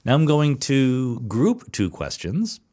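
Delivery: ordinary talk, nothing marked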